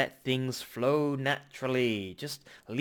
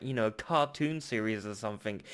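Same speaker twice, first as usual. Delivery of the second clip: ordinary talk, nothing marked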